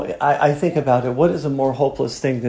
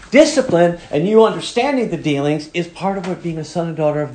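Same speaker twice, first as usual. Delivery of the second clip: raised, driving preacher's voice; fiery preaching; 5 semitones higher, 3 dB louder than usual. Acted. Preacher urging the congregation with rising force. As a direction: press the point home to the congregation.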